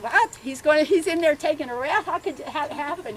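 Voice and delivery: high, loud disbelief as Mom; indignant mother. Retold or imitated. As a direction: mimic Mom scandalised Dad is resting. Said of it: "She said".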